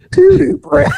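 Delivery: high, indignant repeat. Retold or imitated. Woman on the corner repeating the insult, indignant and high. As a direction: scoff it back in disbelief. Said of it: "hands on her hips going".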